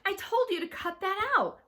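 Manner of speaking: loud, high-pitched scolding; angry shout. A narrator's reading. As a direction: shout it, fed up with her. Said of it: "I shouted".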